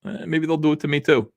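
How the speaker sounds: ordinary delivery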